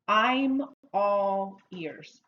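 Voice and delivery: slow, drawn-out teacher demonstration; slow model phrase. Shown as a demonstration. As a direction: stretch each word out for learners to repeat. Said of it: "Try it".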